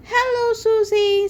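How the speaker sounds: squeaky cheerful hello; Peppa voice. Acted. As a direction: greet your friend like an excited little piglet. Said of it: "says Peppa".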